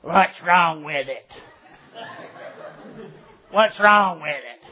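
drawn-out smoker's rasp, mimicking a woman; cigarette voice. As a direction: mockingly imitate a cigarette voice, very slowly. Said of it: "she has that cigarette voice".

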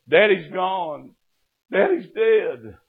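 high, slow, tearful cry; crying news. Retold or imitated. Sister-in-law crying out that her father died. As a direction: break the news through tears. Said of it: "Penny was crying".

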